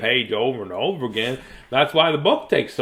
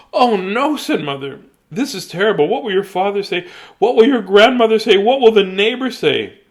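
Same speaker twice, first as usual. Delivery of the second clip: fretting mother; 8 semitones higher, 6 dB louder than usual. Acted. Mother upset about ticket.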